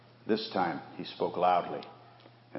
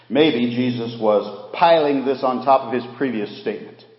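usual speaking voice

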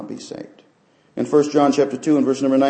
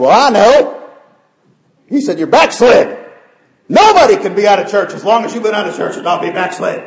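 loud, high imitated pastor's rebuke; shouted rebuke; 11 semitones higher, 9 dB louder than usual. Pastor confronting a member who left church, shouted rebuke. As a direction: act out a pastor loudly confronting someone.

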